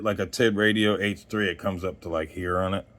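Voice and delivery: ordinary talk, nothing marked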